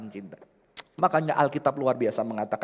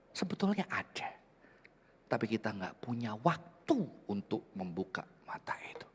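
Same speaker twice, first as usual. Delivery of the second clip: tearful whisper. Acted. Pastor moved to tears, whispering to the church. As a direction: voice breaking into a hush.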